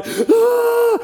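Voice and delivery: high, loud imitated baby wail; a sudden shrill cry like a dropped-off baby; baby scream. Parent mimics a baby crying at drop-off, high and loud.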